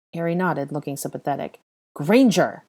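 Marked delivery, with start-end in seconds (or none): 1.9-2.6 loud bark of a surname; barked name. A narrator's reading. Malfoy summoning Hermione again, a loud bark. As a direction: snap the name out like a command. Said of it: "the man barked again".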